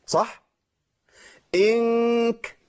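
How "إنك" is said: إنك with the noon hidden in a long nasal hum; ikhfa demo. Shown as a demonstration. Teacher demonstrating ikhfa of noon on إنك. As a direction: hold the nasal sound slowly in إنك. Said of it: "أنتوا عارفين كده أنا عملت إيه؟".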